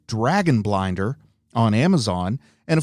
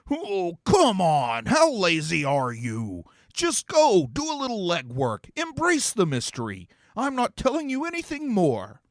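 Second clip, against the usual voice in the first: exasperated demon; 6 semitones higher than usual. Acted. Demon to lazy heroes, exasperated and mocking. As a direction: groan it at them like a put-upon demon.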